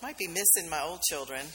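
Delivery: chuckling through the words, light and amused; laughing. Real, not acted. Preacher jokes to congregation about new children, laughing. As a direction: laugh at the absurd thought mid-sentence.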